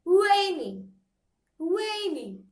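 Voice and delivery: "Rainy" with a high RAI and falling end; stress on first syllable. Shown as a demonstration. Teacher repeats the example for students, "Rainy" stressed on rai. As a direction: lean hard on RAI in "Rainy". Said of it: "stress on the syllable number one".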